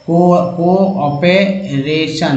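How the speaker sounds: word stretched out very slowly, syllable by syllable; slow syllable demo. Shown as a demonstration. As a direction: spell it out slowly for learners. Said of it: "teacher sounds out word". (शब्द को बहुत धीरे, टुकड़ों में बोलना)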